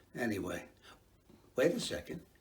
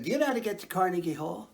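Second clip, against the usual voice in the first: stranger asking; 4 semitones higher, much faster than usual. Retold or imitated. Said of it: "and he said".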